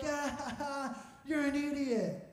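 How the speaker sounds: imitated crew laughing, slow taunt; acting out mocking laughter; mock laughing jeer. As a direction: jeer through a put-on laugh. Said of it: "They all start laughing at me".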